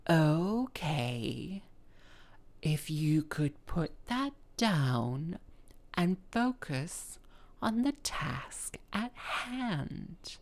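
slow, hushed, patronizing vicar voice; condescending vicar. Retold or imitated. Vicar scolds the musician holding a bouquet, condescending. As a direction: draw it out very softly, talking down to her. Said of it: "This is how he talked to me".

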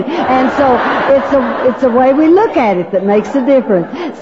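normal talking voice

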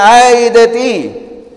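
long drawn-out, high, loud cry; woeful warning. Retold or imitated. Preacher voicing the Lord's woe, drawn-out loud warning. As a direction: stretch the woe out slowly and loudly. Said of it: "Cuando el Señor decía".

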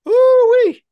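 very high, loud, drawn-out whoop; excited whoop. Acted. Fan hyping the team-up, excited whoop. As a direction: burst out in a falsetto hype cry.

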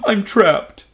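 high, tearful little cry; trapped dragon sniffling to the girl. Acted. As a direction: plead through sniffles. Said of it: "the dragon sniffled".

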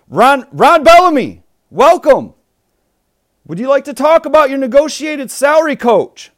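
loud, high eager interviewer; reporter voice. Acted. Mock press conference interviewer.

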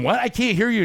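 high, loud mock yell; mocking a yelling woman with fake deafness; mock shouting over noise. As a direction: pretend a band is drowning her out. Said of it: "I'm going".

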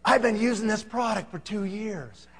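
hushed, slow admission; secretive confession. Retold or imitated. Client confessing to salesman, secretive hush. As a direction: confide it softly, as if nobody should know. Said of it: "like he didn't want anybody to know".

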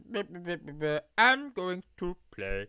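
high-pitched bumbling drunk impression. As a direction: babble along like a determined drunk. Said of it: "I should change my voice".

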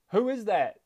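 high, loud questioning call; curious shout. A narrator's reading. Boy calling to the unknown voice, curious shout. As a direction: call out to an unseen stranger. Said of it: "shouted curiously".

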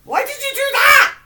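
piercing, very high shriek; pained screaming. Real, not acted. Asking a friend why they hurt her, pained scream. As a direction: wail the question at full volume. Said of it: "You're gonna wake everybody in your house up".

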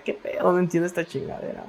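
whispered, baffled curse. Retold or imitated. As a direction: mutter it under your breath in confusion. Retold baffled reaction to videos. (susurro desconcertado)

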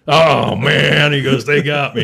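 high, loud mock groan of defeat; mock dismay. Coworker opening his stuffed locker, high loud mock dismay. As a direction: lament being pranked, hammed up.

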